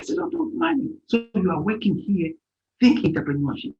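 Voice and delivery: normal talking voice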